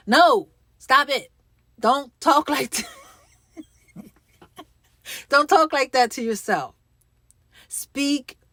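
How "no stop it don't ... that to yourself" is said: louder and higher, cutting it off; firm scolding. Acted. Speaker scolding the listener's gloomy inner voice, loud and firm. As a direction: stop that talk right now.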